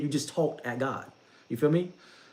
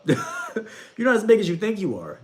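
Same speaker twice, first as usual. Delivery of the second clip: laughing through the words, slower; 7 semitones higher, 4 dB louder than usual. Real, not acted. Teasing a proud listener, laughing while saying it. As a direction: chuckle out the line as you say it.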